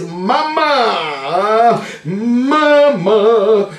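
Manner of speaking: long, drawn-out affectionate call; calling Mama. Real, not acted. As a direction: stretch each syllable way out. Calls out to Mama.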